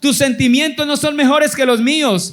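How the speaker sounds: fervent preaching. Acted. Preacher urging his people to trust God, fervent preaching. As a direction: preach it out to the congregation with fire.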